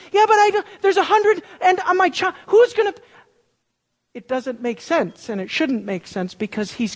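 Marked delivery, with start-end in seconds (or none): 0.1-2.9 much higher and louder, frantic; frantic panic. Retold or imitated. Preacher voicing Abraham panicking about a child at a hundred, much higher and louder. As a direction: blurt it out in a panic. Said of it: "a place of panic".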